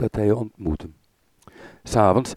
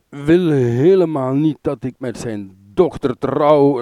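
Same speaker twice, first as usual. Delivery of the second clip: put-on slow, slurred, louder and higher drunken complaint; drunken slurring; 5 semitones higher, 5 dB louder than usual. A narrator's reading. Drunk Orion complains about the king, slow and slurred. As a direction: babble it out drunk and aggrieved. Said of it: "lalde hij".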